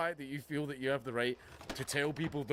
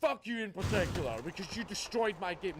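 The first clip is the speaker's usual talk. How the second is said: higher mock rage at a passer-by; mock angry fan; 8 semitones higher than usual. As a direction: lampoon a seething fan yelling at strangers. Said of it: "walking down the street past random women going".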